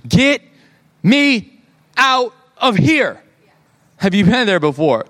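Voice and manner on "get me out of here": high, slow mock panic, word by word; retold freak-out. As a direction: space out each word in an acted-out panic. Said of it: "I'm freaking out".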